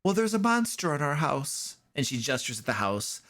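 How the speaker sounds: put-on high, polite little girl greeting strangers. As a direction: pitch up into a small girl's voice. Girl tells of the monster.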